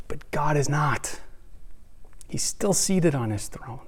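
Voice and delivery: whispered. Acted. Pastor whispering to his congregation that God is not out of control. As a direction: let the words drop to a breath. Sermon turning point, hushed.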